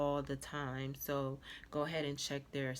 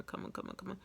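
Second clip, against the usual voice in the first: whispered coaxing; 4 semitones higher, 5 dB quieter, faster than usual. Real, not acted. Coaxing the camera to focus.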